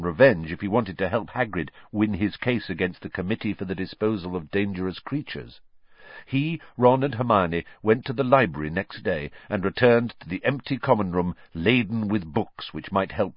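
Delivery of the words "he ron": usual speaking voice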